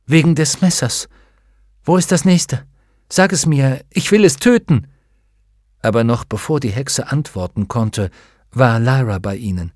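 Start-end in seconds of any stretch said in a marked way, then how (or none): 0.1-4.9 high, louder, insistent questioning; urgent demand. Acted. Demanding to know where the nearest one is, urgent and determined. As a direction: press for an answer, pitched up and forceful.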